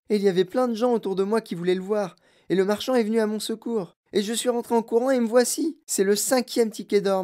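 put-on high, eager child telling big news; thrilled, high-pitched storytelling; excited boy. A narrator's reading. Boy announces his winning ticket.

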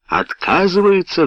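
high-pitched, incredulous outburst; roared question. A narrator's reading. Helsey hears his order is refused, roaring. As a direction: echo it back in outrage, pitch leaping. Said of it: "взревел Хелси".

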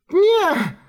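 high startled yelp; sudden cry. Acted. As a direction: cry out as the wave hits. Shout cut off by wave.

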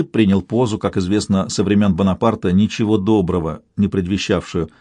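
ordinary delivery

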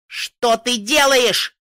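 slow, loud, high cry of horror; terrified scream. A narrator's reading. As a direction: scream it in terror. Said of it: "закричала я в ужасе".

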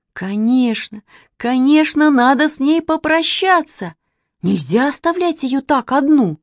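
high, urgent agreement; eager resolve. A narrator's reading. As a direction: agree emphatically, voice rising. Eager decision to go to her.

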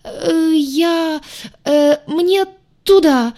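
high, slow, frightened stammering; startled stammer. A narrator's reading. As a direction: stumble over the words in fright.

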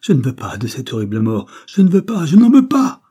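terror building to a high shout; panicked refusal. A narrator's reading. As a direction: refuse in mounting panic, ending in a loud high cry. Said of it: "le bandit affolé de terreur". (refus affolé, qui monte jusqu'au cri)

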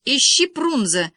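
loud, slow, high-pitched order; commanding. A narrator's reading. Boy orders girl to search the map, commanding. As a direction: give a firm, spelled-out command. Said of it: "велел Серёжка".

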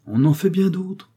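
a wry smiling reply, lifted high; with a smile. A narrator's reading. Young woman answering her friend with a knowing smile. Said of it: "dit la jeune femme avec un sourire".

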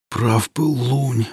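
slow, hoarse and soft; hoarse quiet voice. Acted. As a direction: rasp it out wearily. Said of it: "тихо прохрипел".

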